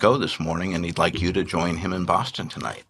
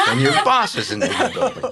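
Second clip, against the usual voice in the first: laughing mock repeat; 5 dB louder than usual. Mimicking assistant's flustered repeat.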